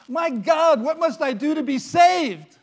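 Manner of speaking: high loud anguished plea; desperate cry. Acted. Man cries to Saint Peter how to be saved, loud desperate cry. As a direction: cry out for salvation.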